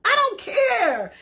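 high-pitched, carefree I-don't-care cry; defiant outburst. Retold or imitated. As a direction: throw it off like nothing can touch you. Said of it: "I said".

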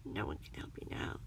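low, soft villain's sneer. A narrator's reading. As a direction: sneer it deep and quiet. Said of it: "the man sneered".

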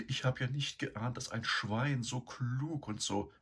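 breathed. A narrator's reading. Sow swooning over Eduard in his dream, breathy high sigh. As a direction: breathe his name, lovestruck. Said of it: "hauchte sie".

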